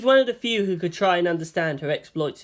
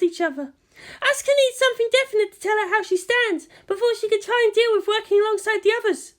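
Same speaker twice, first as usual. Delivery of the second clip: put-on light, much higher girlish voice for Maya; eager assistant to her senior, higher voice; young Maya voice; 4 dB louder than usual. A narrator's reading. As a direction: play the eager junior assistant, high and bright.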